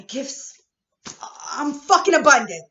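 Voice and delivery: slow build into a loud high shout; emotional outburst. Real, not acted. To her viewers about her gifts, an emotional shout. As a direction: let it burst out of her. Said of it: "I got a little emotional with this one".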